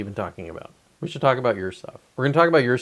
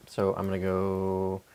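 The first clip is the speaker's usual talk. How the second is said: muttered under the breath while typing; a quiet whisper while typing; whispering to self; 4 semitones lower, 10 dB quieter, much slower than usual. Real, not acted. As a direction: mumble the search terms to yourself.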